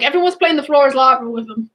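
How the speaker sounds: ordinary delivery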